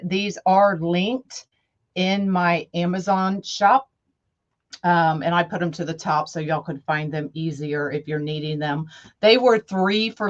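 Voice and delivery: ordinary delivery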